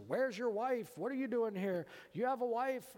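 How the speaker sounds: high, loud prying questions; lightly voiced tipsy stranger; nosy drunk woman. Drunk woman quizzes the pastor about his wife, loud and high.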